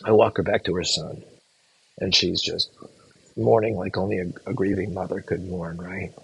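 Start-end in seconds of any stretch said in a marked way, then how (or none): 2.0-6.1 real sorrow, hushed and halting; heavy, sorrowful. Real, not acted. Recalling a grieving mother beside her son, heavy and hushed. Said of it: "so it's heavy now".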